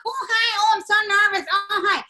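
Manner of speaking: very high, loud, nervous character squeal; It's Pat character voice. Acted. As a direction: stay fully committed to a high cartoon voice. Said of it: "I'm like".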